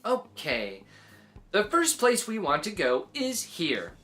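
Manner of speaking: plain reading voice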